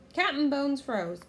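plain reading voice

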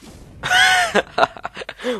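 very high shocked laugh; high squealing laugh. Real, not acted. Gamer laughs very high in shock.

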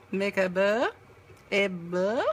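bow said in a mock French accent. Shown as a demonstration. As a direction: say it like a Frenchman would. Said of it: "That's me trying to speak French".

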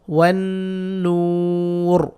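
"ওয়ান নূর" very slow, n hummed and "নূর" stretched; chanted "ওয়ান নূর" with long nasal and long vowel; pausal pronunciation. Shown as a demonstration. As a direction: hold the oo in "নূর" and stop on a vowelless r. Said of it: "তাহলে উচ্চারণ হলো".

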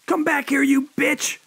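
high, loud furious yell. A narrator's reading. Killer yelling after fleeing Annette, furious shout. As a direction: bellow it after her in rage. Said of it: "John yelled".

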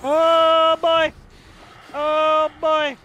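high, loud panicked cries; real panic. Real, not acted. Player spotting the monster behind him, panicked. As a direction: cry out in rising dread.

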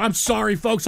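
ordinary delivery

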